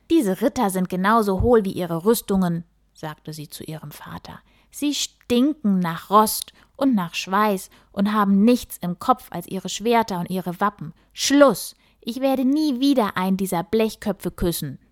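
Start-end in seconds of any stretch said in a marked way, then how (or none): 0.1-2.6 loud, indignant outburst; fed up with the knights, let it burst out; angry princess. Acted. Princess scolds her father. 4.7-14.8 loud, indignant outburst; fed up with the knights, let it burst out; angry princess. Acted. Princess rants at father.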